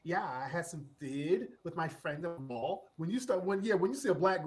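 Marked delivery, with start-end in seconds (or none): 0.0-2.9 put-on dumb Valley girl. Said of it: "that dumb Valley girl voice inflection".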